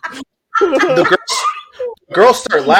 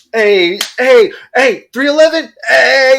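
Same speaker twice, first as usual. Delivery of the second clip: loud, high whoops of a hyped concertgoer; rowdy high-five hollering; 9 semitones higher, 6 dB louder, slower than usual. Retold or imitated. High colleague hailing random people at a concert, rowdy hollering. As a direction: holler greetings at strangers, pitched way up.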